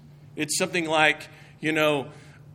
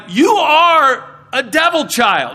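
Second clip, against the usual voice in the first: higher, louder mock scandalized accusation, slow; accusing churchgoers; 6 semitones higher, 8 dB louder, slower than usual. Congregation scolds the seated newcomer in a hammed-up accusing voice. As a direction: play the shocked congregation glaring. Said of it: "everybody's gonna look at you like".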